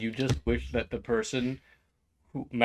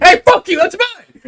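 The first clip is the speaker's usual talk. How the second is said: very high, loud outraged screech; furious yell; 17 dB louder than usual. Acted. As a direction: scream it like your idea was stolen. Beer guy furious at theft.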